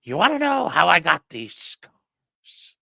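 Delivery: slow, lightly voiced Joker; a hint of the Joker, drawn out; Joker impression. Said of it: "Karen's like".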